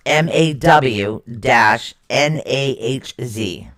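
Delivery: slow, letter by letter; spelled-out pronunciation. Shown as a demonstration. Host correcting the rune name, slow spelling. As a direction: spell it slowly, each letter separate. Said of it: "it's pronounced".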